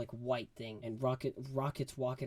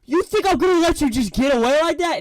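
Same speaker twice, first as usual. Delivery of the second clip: loud, much higher snarl; villain rage; 14 semitones higher, 16 dB louder than usual. Retold or imitated. High Extraordinary threatening Rocket, loud and furious. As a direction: roar the threat. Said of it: "High Extraordinary is just like".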